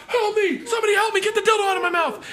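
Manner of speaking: mock desperate plea. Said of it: "And I'm just like".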